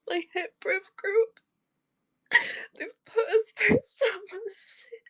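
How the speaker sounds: bitter, high-pitched tearful complaint; crying. Real, not acted. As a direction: sob out the grievance. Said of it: "I'm just crying".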